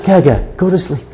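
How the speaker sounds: soft imitation of a toddler; a hushed little bedtime command, imitated; lightly voiced granddaughter. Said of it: "And then she says".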